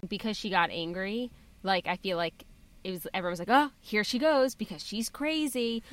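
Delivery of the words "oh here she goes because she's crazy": higher, drawn-out mock judgement; mock exasperation. Mimicking everyone judging her anger, mock exasperation. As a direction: imitate dismissive onlookers, slowly.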